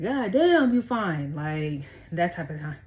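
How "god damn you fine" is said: loud, high burst on the first words, then dropping; admiring catcall. Acted. Reader imitates an admirer barking at someone, admiring catcall. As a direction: play an appreciative flirt eyeing someone. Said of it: "in a good way".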